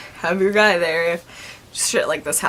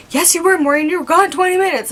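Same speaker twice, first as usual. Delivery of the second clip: high, loud retort in a retold argument; insistent contradiction; 8 semitones higher, 5 dB louder, faster than usual. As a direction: argue back emphatically, pitched well up. Acted argument with coworker who denies.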